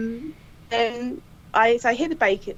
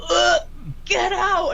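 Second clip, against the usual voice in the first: disgusted yell; 11 semitones higher, much slower than usual. Retold or imitated. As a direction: recoil from the smell and shout. Colleague hit by the stench.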